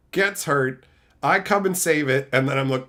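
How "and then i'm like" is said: ordinary talk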